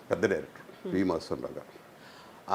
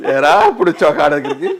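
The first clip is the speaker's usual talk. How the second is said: a fast teasing question with a chuckle; amused smirking tease; 2 semitones higher, 9 dB louder, faster than usual. Retold or imitated. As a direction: gloat with a sly laugh. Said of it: "ఒక ముసి నవ్వుడు". (ముసిముసి నవ్వుతో ఎగతాళి)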